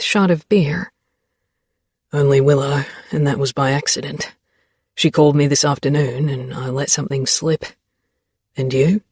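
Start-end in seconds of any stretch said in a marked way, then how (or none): 2.1-9.0 lightly lowered male voice; a hint of a teasing young man; Xavier voice. A narrator's reading.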